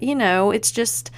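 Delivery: ordinary delivery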